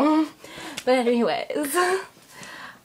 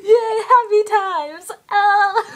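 high, loud, drawn-out hooray; mock cheer; 9 semitones higher, 5 dB louder, slower than usual. Comedian cheering about happy times, hammed-up delight. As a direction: stretch it out in a put-on happy squeal.

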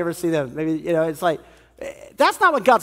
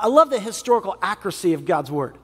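ordinary talk

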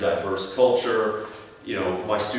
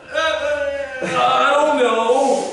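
high, drawn-out, loud helpless wail; hammed-up panic, stretching the words out; clueless whine; 5 dB louder, much slower than usual. Unprepared graduate asked about jobs, helpless whine.

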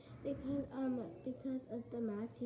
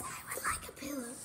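whispered reply; whispering; 4 dB quieter than usual. Pretend. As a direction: answer in a whisper. Whispered answer in role.

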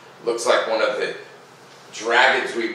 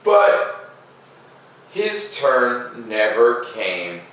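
a lifted "But", then a slow, drawn-out fall; story narration; 4 semitones higher, much slower than usual. A narrator's reading. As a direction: let the sad turn land slowly. Reading the picture book aloud.

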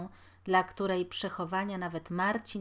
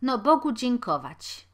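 higher, louder cheerful exclamation; washerwoman's voice; 5 semitones higher, 8 dB louder than usual. A narrator's reading. Washerwoman scrubbing laundry, thanking God out loud. Washerwoman speaks, first take.

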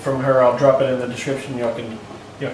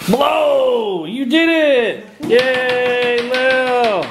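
cheering; 10 semitones higher, much slower than usual. Real, not acted. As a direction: cheer her on, high and bright. Cheering child blowing candles.

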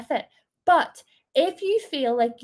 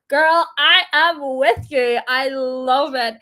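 loud, slow, emphatic agreement; 7 dB louder, slower than usual. Real, not acted. To a viewer who named the shelf after herself, a loud, slow agreement.

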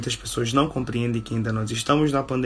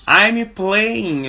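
higher and louder example word; teaching model; 7 semitones higher, 5 dB louder than usual. Shown as a demonstration. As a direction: call it out for the class. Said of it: "eu estou jogando".

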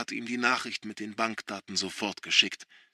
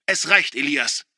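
louder firm outburst; getting loud; 3 semitones higher, 8 dB louder than usual. A narrator's reading. Son raising his voice at father, loud. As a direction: cut him off sharply. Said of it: "Nun ist auch Josh laut geworden".

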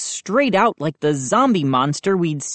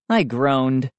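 ordinary delivery, nothing marked

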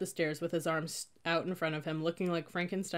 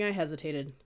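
ordinary delivery

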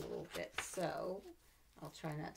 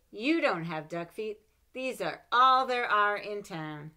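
put-on proud taunting child, a bit louder; boasting child; 4 semitones higher, 13 dB louder, slower than usual. Child boasting to Big Bill Brown, teasing. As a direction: crow at Big Bill like a kid. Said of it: "I would say".

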